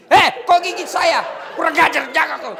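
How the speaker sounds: shouted high and loud like a bitten mother; mock furious yell. Nursing mother yelling at her biting baby, loud mock anger.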